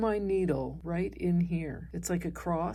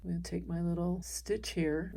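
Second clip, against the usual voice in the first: ordinary talk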